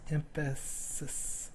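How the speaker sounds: drawn-out whispered sounding-out of a word; slow whisper. Real, not acted. Teacher sounding out a word for the class, slow whisper. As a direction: whisper it syllable by syllable, very slowly.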